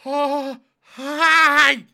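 very high, loud pained cry; pained shriek. Real, not acted. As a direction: shriek from the chili burn. Chili burn hits.

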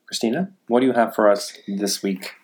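ordinary talk

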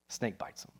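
whispered punchline, nearly no voice; hushed. Acted. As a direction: drop to a breathy whisper for the bite. Dramatic hushed payoff of story.

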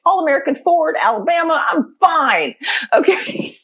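high, emphatic mock certainty; retold giddy confidence. Storyteller acts out convincing herself about the stranger, giddily confident. Acts out her sudden reassurance.

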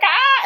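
sudden high yelp of shock; startled yelp. Retold or imitated. Shrieking at the smashed frog, high startled yelp.

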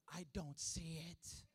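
put-on grown-up toddler. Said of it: "like if he's twenty years old".

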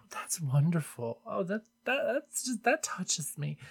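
soft, touched whisper. Real, not acted. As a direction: whisper it, moved. Touched by a review.

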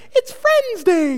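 very high, slow, drawn-out squeal; mock Minnie and Mickey greeting; cartoon announcer. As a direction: stretch it out like a costumed character greeting a crowd. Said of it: "they're like".

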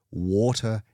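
usual speaking voice

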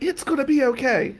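high-pitched imitation of an aunt; distraught aunt. Aunt reassuring nephew she fears needs help, imitated high voice. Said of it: "She's like".

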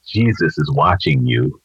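low, lightly voiced eerie warning; creepy mystery voice. Unseen voice warns the burglar a second time, mildly creepy. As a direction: drop it low, a little ominous. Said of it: "this is getting kinda freaky".

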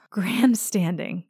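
slow, amused echo of "Grandstanding"; amused repeat. Retold or imitated. Jamie echoing Claire's insult, amused and slow. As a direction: drawl "Grandstanding" back, trying not to laugh. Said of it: "finding it hard not to laugh".

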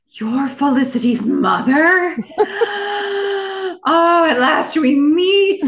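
high, loud, slow gushing through laughs; overplayed grand dramatic greeting; slow theatrical villain menace. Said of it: "the way it".

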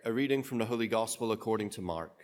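measured, solemn liturgical reading; Gospel proclamation. Acted. Priest reading the Gospel at Mass, solemn and measured.

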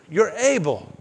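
ordinary talk

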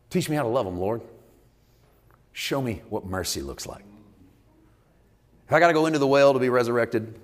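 usual speaking voice

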